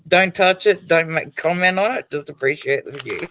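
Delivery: laying down the rules, half joking; teasing rules. Retold or imitated.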